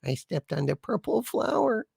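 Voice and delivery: high, soft crying woman's voice. A narrator's reading. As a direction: whimper it as the weeping beauty. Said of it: "And crying, the woman turns".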